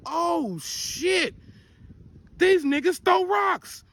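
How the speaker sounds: high, stretched exclamation building to a shout; retold shock. Retold shock at rock fight.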